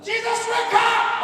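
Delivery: shouting in terror at the sleeping Jesus; panicked shout. Retold or imitated. Preacher voicing terrified disciples, shouting to wake Jesus. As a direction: cry out desperately over the storm.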